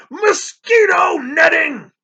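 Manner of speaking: high, slow, shouted scorn; outraged shout. A narrator's reading. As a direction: explode on the words "Mosquito netting".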